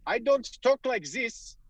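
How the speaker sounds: high, put-on Nigerian accent mocking a wrestler; jokey Nigerian accent impression. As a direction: lampoon an exaggerated Nigerian accent. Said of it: "if Apollo Crews would drop the accent".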